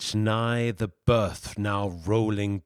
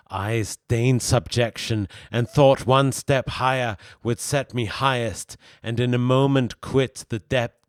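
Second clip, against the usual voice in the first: impassioned Satan; 3 semitones higher, 5 dB louder than usual. Acted. Satan argues with himself over his fall, impassioned. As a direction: declaim it with rising agitation. Satan's anguished soliloquy, acted in reading.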